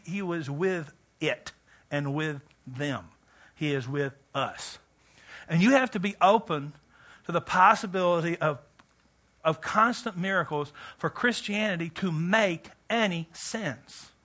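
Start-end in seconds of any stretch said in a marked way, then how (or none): none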